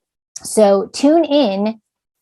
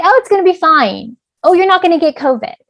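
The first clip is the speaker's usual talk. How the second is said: higher, louder breezy brush-off; dismissive reassurance; 9 semitones higher, 5 dB louder than usual. Acted. Parent brushing off an anxious child, dismissive. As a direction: wave the worry away in a pitched-up voice. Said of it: "Don't say".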